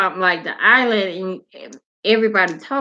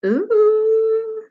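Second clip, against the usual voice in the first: high delighted squeal of ooh; excited ooh; 13 semitones higher than usual. Real, not acted. Reading the ladies' night suggestion, a high excited ooh. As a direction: squeal at the idea.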